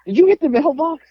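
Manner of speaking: high-pitched mock mother demanding an answer; imitated mom. Mom confronting her son over the mailbox, high mock indignation. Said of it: "my mom comes over and she's like".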